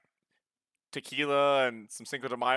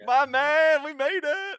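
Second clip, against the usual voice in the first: much higher cheering voice; excited cheer; 14 semitones higher, 4 dB louder, much slower than usual. Real, not acted. Player cheering that the party made it out, excited. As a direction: cheer the escape like a win.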